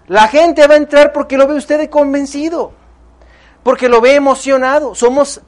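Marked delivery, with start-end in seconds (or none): 0.1-2.7 louder, higher repeat. Shown as a demonstration. Speaker to seminar audience, pressing his point, higher and louder. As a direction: pitch up and push the volume on the repeat.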